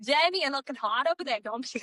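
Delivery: mock Midwest accent. Teasing a friend in a playful Midwest accent. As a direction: flirt jokingly in a put-on Midwest accent. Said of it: "Are you talking Midwest?".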